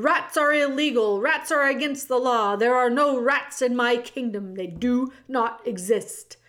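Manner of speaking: loud, stubborn royal decree; ranting king. Acted. The king loudly denies that rats exist. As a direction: lay down the law.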